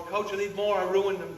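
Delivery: high, loud mock plea of a goalkeeper; pleading player. Coach imitating his goalkeeper begging for new gloves, loud high plea. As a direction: beg the coach like a keeper who wore out her gloves.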